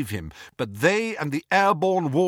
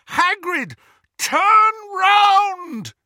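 desperate yell; 7 dB louder, much slower than usual. Acted. Pleading to turn back.

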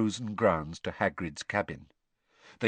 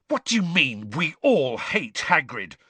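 furious schoolboy snapping back, a bit louder; spat; 6 semitones higher, 4 dB louder than usual. A narrator's reading. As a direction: spit the words at a bully. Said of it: "Harry spat at Malfoy".

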